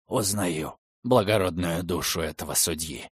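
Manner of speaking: low, sorrowful and soft; mournful, heavy-hearted voice; quiet grief. Acted. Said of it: "скорбным голосом воскликнул каторжник".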